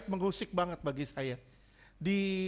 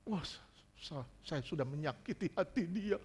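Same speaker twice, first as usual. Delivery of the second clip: soft, halting acted regret; acted remorse; 8 dB quieter than usual. Preacher voices a husband who hurt his crying wife, softly remorseful. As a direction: stammer softly as a sorry husband.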